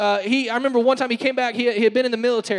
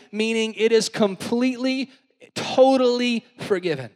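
normal talking voice

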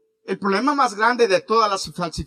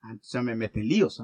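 1 mock dismay; 8 semitones lower, 3 dB quieter than usual. Pastor's mock dismay at accusation.

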